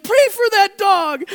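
high, loud urgent plea; pleading friend. Retold or imitated. Sobbing friend urges pastor, high loud plea. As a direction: beg him to pray, high and loud. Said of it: "she's like".

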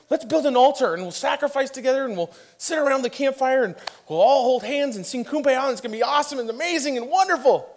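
high, loud, gushing overexcited Peter impression; hammed-up giddy enthusiasm; giddy excitement. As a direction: gush like an overexcited disciple, shooting up into a squeal. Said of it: "just getting giddy and".

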